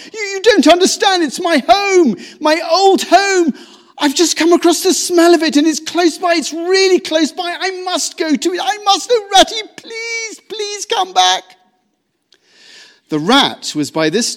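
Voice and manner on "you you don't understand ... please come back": high, loud pleading, rushing words; desperate pleading. A narrator's reading. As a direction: plead with your whole heart. Mole begging, anguished.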